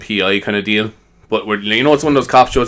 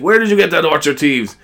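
demanding interrogation; 6 semitones higher, 3 dB louder than usual. Acted. Cop interrogating a young fella.

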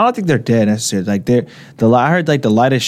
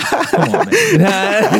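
high, laughing plea; high-pitched words breaking into laughter; laughing protest; 9 semitones higher, much slower than usual. Real, not acted. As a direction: crack up while protesting. Laughing after mock plea to mom.

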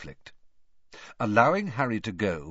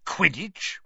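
"Quidditch?" higher, rising in outraged disbelief; disbelieving; 7 semitones higher than usual. A narrator's reading. Uncle repeating the letter's word, scornful rising question.

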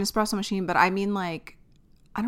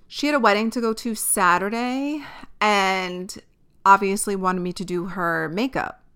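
ordinary delivery

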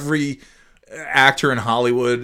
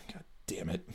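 whispered curse; 3 semitones higher, 21 dB quieter than usual. Real, not acted. Frustrated, forgetting a name.

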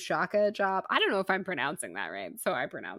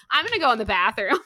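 louder, higher defiant announcement; mock defiance; 5 semitones higher, 8 dB louder, faster than usual. Wife overruling her rule-follower husband, mock defiance. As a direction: declare it in playful defiance.

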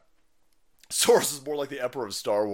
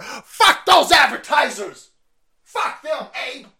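high, loud acted outburst; yelled, hammed-up defiance; defiant shout; 10 semitones higher, 9 dB louder, slower than usual. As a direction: shout it in mock fury. Acted defiant retort to advertisers.

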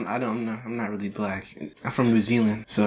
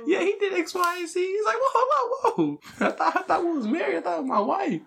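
very high, laughing husband voice; shocked mock husband. Husband stunned at wife's arrest, squealing in high laughter.

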